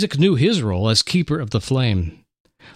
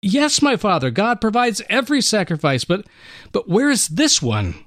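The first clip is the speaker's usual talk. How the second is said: urgent son; 7 semitones higher than usual. Acted. Son pressing his father.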